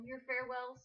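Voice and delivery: ordinary talk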